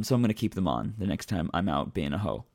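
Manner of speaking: normal talking voice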